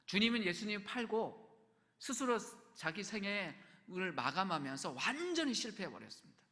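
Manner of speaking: usual speaking voice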